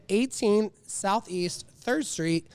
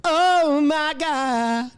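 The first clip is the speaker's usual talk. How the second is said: slow, high, drawn-out gasp; drawn-out Oh my God; 8 semitones higher, 4 dB louder, much slower than usual. Acted. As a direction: stretch it out like a soundbite. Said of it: "I'm gonna be one of these buttons".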